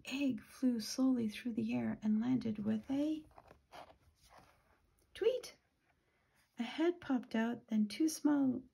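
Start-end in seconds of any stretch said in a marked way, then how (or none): 5.1-5.6 very high-pitched little chirp; chick's tweet. A narrator's reading. Newly hatched chick lets out a tweet, high chirp. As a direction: squeak it like a tiny bird.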